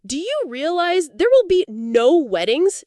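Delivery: loud, high, slow yell. Retold or imitated. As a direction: shout it out at the parents. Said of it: "My husband yelled at them".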